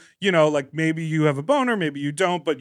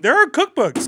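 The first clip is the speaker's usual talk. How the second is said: higher and louder, eager; excited exclaim; 10 semitones higher, 6 dB louder than usual. Real, not acted. Cutting in with the cookbook idea, excited and loud. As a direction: blurt it out, thrilled. Excited interjection mid-pitch.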